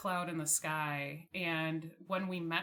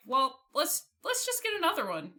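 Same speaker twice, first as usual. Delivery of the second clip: excited idea; 9 semitones higher, 6 dB louder than usual. Retold or imitated. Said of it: "we were like".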